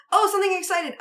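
sudden high excited gasp; mock thrill at the exciting part. Mimicking viewer's roller-coaster reaction.

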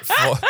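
high squealing laugh. Real, not acted. [name] laughing at the role reversal, squealing laugh. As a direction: crack up high.

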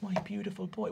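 soft doting father; hushed tender. Acted. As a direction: murmur with deep pride.

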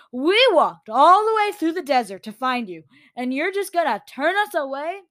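loud, high, incredulous outrage; indignant protest. A narrator's reading. Girl to creature turning them away, indignant. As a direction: challenge them with mounting disbelief.

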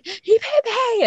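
very high-pitched kid's complaint, imitated; mock tattling child. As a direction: mimic a child squealing to a grown-up. Mimics the misheard childish complaint.

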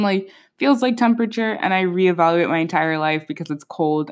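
ordinary talk

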